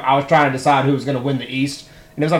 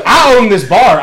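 owner's claim; 5 semitones higher, 6 dB louder, slower than usual. Retold or imitated. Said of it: "she goes".

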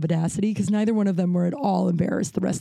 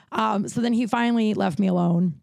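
ordinary delivery

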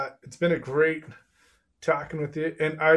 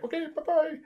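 high, sing-song imitated goodbye; breezy goodbye. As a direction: toss off the goodbye like nothing happened. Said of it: "and he's like".

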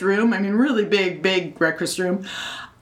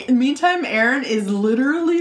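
ordinary delivery

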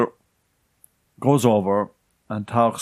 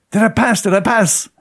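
anxious asking; 10 semitones higher, 4 dB louder than usual. Retold or imitated. Learner driver begging tester's verdict.

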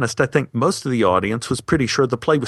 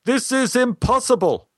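high-pitched call from the back of a theater; booming interruption; 12 semitones higher than usual. Retold or imitated. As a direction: halt the show with urgent, high cries. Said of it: "a voice boomed out from the back of the theater".

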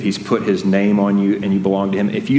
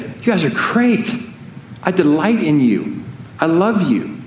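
raised, beaming voice of a delighted God; God's delight; 7 semitones higher than usual. Acted. God smiling on sinful people, beaming and high. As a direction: lift the pitch as if beaming down at them.

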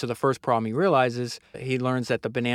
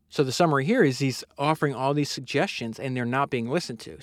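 ordinary talk

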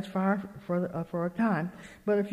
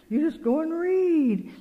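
high, drawn-out exhortation; emphatic urging; 8 semitones higher, 3 dB louder, slower than usual. Retold or imitated. Speaker sending worried friend to scripture, emphatic urging. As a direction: urge her slowly with lifted pitch.

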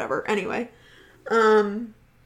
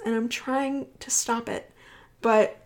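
ordinary talk, nothing marked

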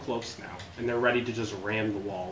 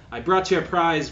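high, loud scheming sister; villainess sister; 6 semitones higher, 7 dB louder than usual. Acted. Sister offers brother a prisoner, high and gloating. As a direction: present the prize with relish. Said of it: "And she's like".

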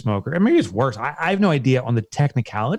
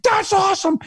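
much higher, gushing Southern customer-service voice; excited Southern rep. Retold or imitated. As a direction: play the overjoyed phone guy from the South. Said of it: "he was so genuinely excited".

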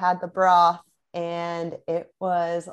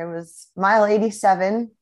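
ordinary delivery

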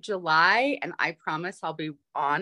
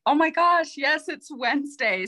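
excited realization; 10 semitones higher, 4 dB louder than usual. Retold or imitated. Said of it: "and I was like".

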